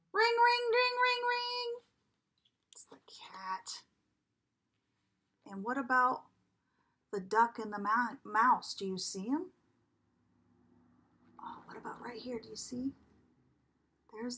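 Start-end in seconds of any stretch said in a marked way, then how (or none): 0.1-1.8 ringing phone. Acted. Reading phone ring sound. 2.7-3.8 whisper. Acted. Revealing hidden cat to child. 11.4-12.9 whisper. Acted. Secret hint to listening child.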